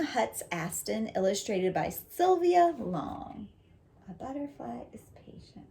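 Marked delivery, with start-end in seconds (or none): 4.1-5.7 soft whisper of the title. Real, not acted. Teacher whispering the book title to the class. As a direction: breathe the title out in a hush.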